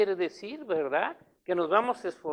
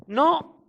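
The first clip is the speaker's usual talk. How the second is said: NO shot up high and loud; emphatic no; 8 semitones higher, 8 dB louder than usual. Real, not acted. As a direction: slam the No down hard. Predicador recalca la negación. ("No" fuerte y agudo)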